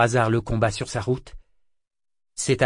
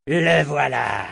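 roar; 3 semitones higher, 3 dB louder, much slower than usual. A narrator's reading. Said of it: "rugit un matelot jamélien".

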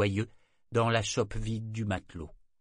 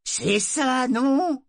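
excited; 7 dB louder, much slower than usual. A narrator's reading. Tarlock triomphant.

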